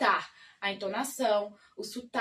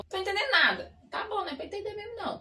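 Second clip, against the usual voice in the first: higher, louder whiny complaint; mock frustrated beginner; 8 semitones higher, 3 dB louder, faster than usual. A beginner watching native stories complains, high and loud. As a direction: wail it like a lost beginner.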